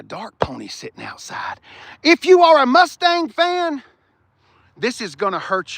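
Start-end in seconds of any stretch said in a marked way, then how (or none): 2.0-3.8 louder announcing. Real, not acted.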